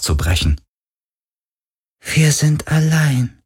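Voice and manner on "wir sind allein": high, slow spirit lament. A narrator's reading. Ghost mourns its vanished creators, slow high lament.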